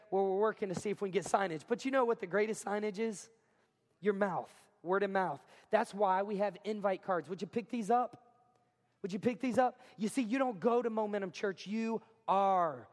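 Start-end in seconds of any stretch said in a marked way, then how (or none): none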